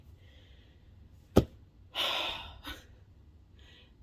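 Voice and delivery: long tired sigh; weary sigh. Real, not acted. Vlogger sighing over a stressful reading schedule, weary sigh. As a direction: let out a drawn-out exhausted breath. Overwhelmed by vlog plans.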